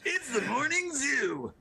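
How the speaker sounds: high, slow, hammed-up radio host; morning zoo DJ. Co-host mocks the intro as a morning zoo radio DJ. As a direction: announce it like a wacky morning radio show.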